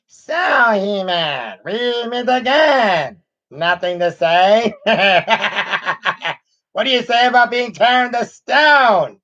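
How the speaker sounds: high, sneering cartoon villain voice; Skeletor voice. Streamer imitates Skeletor for viewers, hammy sneering villain. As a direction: ham up the evil overlord scolding his henchmen. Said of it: "This is his Skeletor voice".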